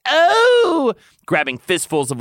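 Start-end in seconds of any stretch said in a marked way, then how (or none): 0.1-0.9 loud, very high acted cry. A narrator's reading. Tamlin overwhelmed by the lover, crying out. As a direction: cry out in character. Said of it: "Tamlin cried out".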